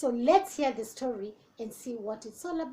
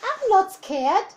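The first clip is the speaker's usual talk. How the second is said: put-on brave child voice; 7 semitones higher, 6 dB louder than usual. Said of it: "said the Gruffalo's child".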